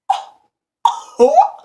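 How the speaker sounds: loud, high awed hoots; mock amazement. Burglar seeing the gold shotgun, mock amazement. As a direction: burst out high, hammed-up wonder. Marveling at diamond gold gun.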